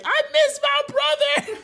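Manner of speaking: very high, slow wail; hammed-up tearful squeal; mock crying. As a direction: imitate a bawling little kid. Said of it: "and then I started crying".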